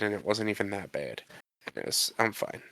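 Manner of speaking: usual speaking voice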